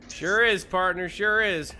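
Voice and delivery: hammed-up Western sidekick; cowboy drawl. Playing Gabby as a loud cowboy. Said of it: "Gabby doesn't talk like that".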